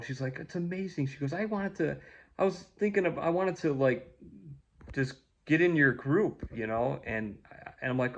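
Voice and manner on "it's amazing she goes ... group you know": a light imitation of a thrilled stranger; imitating an excited young woman. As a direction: let her excitement lift the pitch. Voicing Kim's excited reply.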